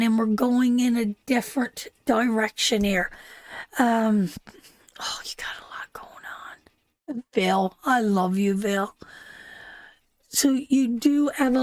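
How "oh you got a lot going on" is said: a real hushed whisper, far softer. Real, not acted. Reader whispering to herself over a viewer's troubles. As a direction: breathe it out under your breath.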